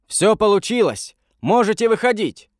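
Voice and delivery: high-pitched narrated call. A narrator's reading. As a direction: call the good news down, voice lifted high. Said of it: "крикнул один из них вниз". (радостный окрик высоким голосом)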